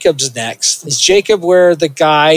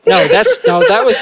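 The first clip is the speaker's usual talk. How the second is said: ordinary talk, nothing marked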